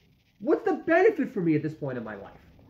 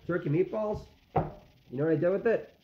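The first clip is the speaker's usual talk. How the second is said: ordinary talk, nothing marked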